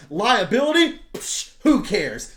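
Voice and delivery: high, loud pushy salesman pitch; mocking a hyped-up pyramid-scheme ad; cheesy ad voice. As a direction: sell it like a smarmy infomercial host. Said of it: "it's like an ad that's talking to you".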